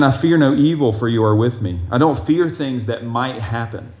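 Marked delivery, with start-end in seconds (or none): 0.0-1.8 loud, declaiming psalm reading; scripture reading. Acted. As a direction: proclaim the verse to the congregation. Rereads verse with emphasis.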